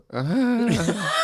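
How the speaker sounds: high, eerie driver's chuckle; haunting laugh. Retold or imitated. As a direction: imitate a creepy, haunting laugh. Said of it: "he does this haunting laugh".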